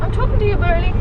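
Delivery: ordinary talk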